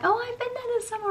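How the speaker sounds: mock the holiday crowd in a high voice; high sing-along parroting of other people; mimicking everyone. Mimics everyone boasting about Mallorca.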